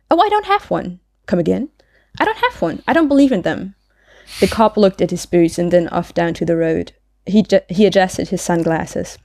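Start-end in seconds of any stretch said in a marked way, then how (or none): 0.1-3.7 high, airy character voice; Maud, airy and unbothered. Acted. Maud telling the policeman she has no license, breezily. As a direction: pitch it high and breezily unconcerned. Reads Maud's part in dialogue.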